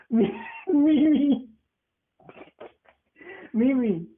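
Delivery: high sing-song name calling; affectionate calling. Real, not acted. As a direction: call her name sweetly.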